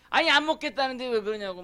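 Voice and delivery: angry retort. Retold or imitated. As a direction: snap back like you've had enough of being pushed. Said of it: "그러면 이제 화를 내요". (흉내 낸 짜증 섞인 큰소리)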